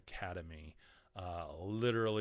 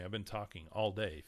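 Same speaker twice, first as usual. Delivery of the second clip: ordinary talk, nothing marked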